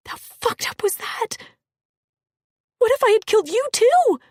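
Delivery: high frightened cry; panicked. Acted. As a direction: cry out in horror. Panic after killing.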